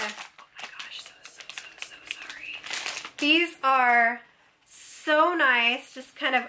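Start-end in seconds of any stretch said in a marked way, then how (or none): 0.0-2.5 hushed apologetic whisper; a whispered sorry to her viewers. Real, not acted. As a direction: whisper it so nobody wakes. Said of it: "I'm trying to be, like, real quiet".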